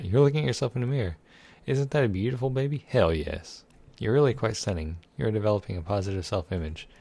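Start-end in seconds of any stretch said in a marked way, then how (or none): none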